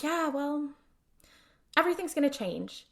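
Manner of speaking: a touch of wistful, reflective line reading; reflective mixed emotions. Retold or imitated. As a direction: say it as if unsure the change is good. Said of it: "it's a really interesting line delivery".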